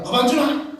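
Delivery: high, loud Gyeongsang father barking for dinner; gruff father's demand. Retold or imitated. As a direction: startle the new daughter-in-law with it. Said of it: "아내가 많이 놀랐어요". (며느리를 놀라게 한 시아버지의 경상도식 호통)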